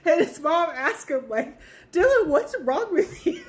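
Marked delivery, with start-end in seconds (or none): 1.9-3.4 mom's voice. Retold or imitated. Mom voiced asking Dylan.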